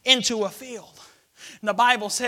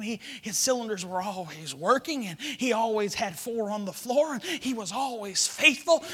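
normal talking voice

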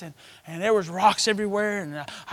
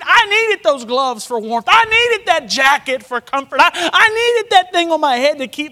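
preaching at full force; 7 semitones higher, 9 dB louder than usual. Real, not acted. Preacher building up.

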